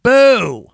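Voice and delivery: loud heckler's boo; shouted boo from the crowd; mock boo. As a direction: belt out a jeering boo. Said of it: "and then she just went".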